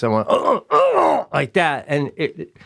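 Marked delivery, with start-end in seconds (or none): 0.3-1.2 loud, high-pitched double grunt. Retold or imitated. Patient re-enacting his exam, grunting. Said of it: "I grunted out loud twice".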